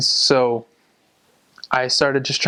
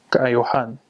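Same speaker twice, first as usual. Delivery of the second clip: "kaayohan" with the "yo" syllable stressed. Shown as a demonstration. Teacher repeating the changed word, "kaayohan" stressed on the O. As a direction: lean on the O in "kaayohan". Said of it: "the emphasis changes to the O".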